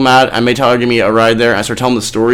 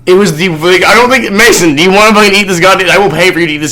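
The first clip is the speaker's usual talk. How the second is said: excited; 6 semitones higher, 4 dB louder than usual. Real, not acted. Daring friend to eat chip.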